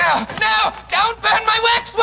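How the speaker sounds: high, desperate shout; frantic pleading cry; panicked shout. Acted. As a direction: scream to stop him. Villain sees his waxworks burning.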